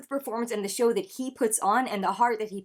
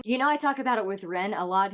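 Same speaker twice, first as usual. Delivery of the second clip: normal talking voice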